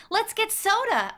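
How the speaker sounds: bright, high eager offer; eager call. A narrator's reading. Julie coaxing her brother toward the diner, eager. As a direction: coax him toward soda happily.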